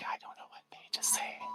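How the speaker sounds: hushed, barely audible whispered aside; a faint, breathy whispered mock reply. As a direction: whisper it so only the daughter hears.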